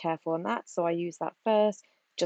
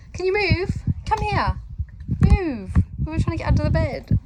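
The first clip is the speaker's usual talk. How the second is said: much higher, louder giggly shooing of the dog; high laughing plea for the dog to move; 10 semitones higher, 6 dB louder than usual. Real, not acted. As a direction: shoo the dog away while cracking up.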